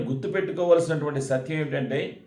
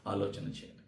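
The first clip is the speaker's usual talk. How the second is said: breathy hush, voice dropped right down; whispered aside; 6 semitones lower, 13 dB quieter, faster than usual. Real, not acted. Preacher whispering to the congregation to think. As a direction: drop to a whisper to make them think.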